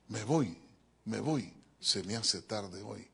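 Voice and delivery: ordinary delivery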